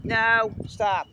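loud firm no, stop; sharp shout at dog. Real, not acted. Owner shouting at her dog to stop, loud. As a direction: bark the command out loud.

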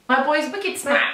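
put-on Boston accent. Trying an American film line, mock Boston accent. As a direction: quote the movie in Boston accent. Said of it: "That film that, that Matt Damon's in".

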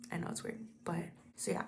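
quick embarrassed whisper; fast sheepish whisper; whispered aside. Real, not acted. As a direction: toss it off under your breath. Sheepish aside while editing.

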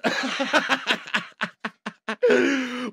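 soft drawn-out laugh; laughter. Real, not acted. Fan laughing at his own joke.

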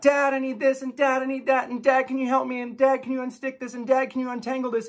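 put-on high, loud kid voices in a barrage; children pestering dad nonstop; kids nagging. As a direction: rattle off kid requests. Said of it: "I've got".

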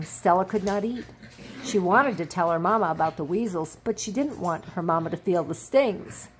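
plain reading voice

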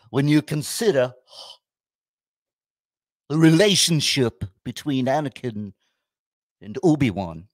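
a hint of a pompous college professor; mock professor. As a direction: lecture it like a professor. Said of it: "I look like a frickin' college professor".

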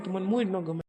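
ordinary delivery

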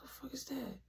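startled whisper in the dark. Retold or imitated. Hearing a noise in the dark house, alarmed whisper. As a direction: whisper it so nobody wakes.

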